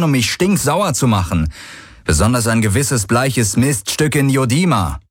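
a fuming rebel telling them off; angry cocky hero. Acted. Hero mocks both factions.

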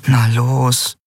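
a restrained inward plea; silent pleading. A narrator's reading. Nelen willing the guard to leave, pleading. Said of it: "flehte Nelen stumm".

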